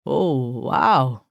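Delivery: put-on low, stunned reaction in an African accent; stunned African cabbie. A narrator's reading. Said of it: "African cab driver was just as stunned".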